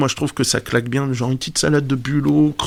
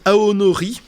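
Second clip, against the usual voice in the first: aonori said with a put-on Japanese accent, rising at the end; mock Japanese pronunciation of aonori; 5 semitones higher than usual. Shown as a demonstration. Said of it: "excusez mon accent japonais aussi".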